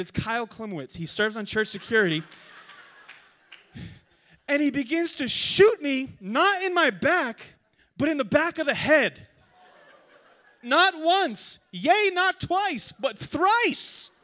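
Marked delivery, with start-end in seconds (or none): 4.5-9.3 high, loud, drawn-out indignation; dramatic outraged retelling. Retold or imitated. Preacher telling church about being shot by his teammate, dramatic outrage. Dramatic climax of paintball story. 10.6-14.1 high, loud, stretched-out proclamation; mock-grand declamation. Preacher counting paintball hits for church, mock-grand declamation.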